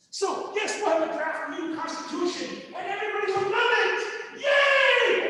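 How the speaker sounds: high, loud, fast mock cheer; hammed-up naive triumph; mock celebration. Mocking leaders' naive optimism.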